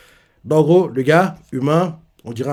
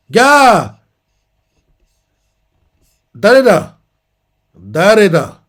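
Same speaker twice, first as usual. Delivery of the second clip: louder and higher, barked out; commanding call; 6 semitones higher, 9 dB louder, much slower than usual. Retold or imitated. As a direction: challenge the intruder. Mysterious figure calls out.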